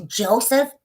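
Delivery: "Joseph?" high, swooping up at the end; mock her questioning "Joseph"; mimicking her talk. Showing how a rival talks, mocking high mimicry. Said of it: "That's how she talks".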